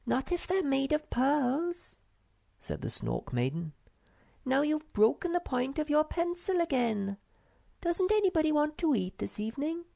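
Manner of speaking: put-on high, airy girlish voice, teasing her brother; a little sister brushing off the fuss; Snork Maiden voice.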